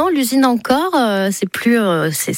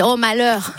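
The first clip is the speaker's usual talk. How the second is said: put-on Niçois accent; 5 semitones higher, slower than usual. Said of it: "Ah, quand je suis énervée".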